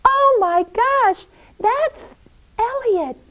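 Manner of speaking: very high, astonished exclamation; high-pitched awestruck voice; amazed realization. Acted. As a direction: gasp it out in wonder. Nora realizes who saved Paul.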